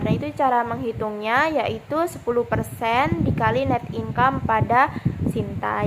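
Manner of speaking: usual speaking voice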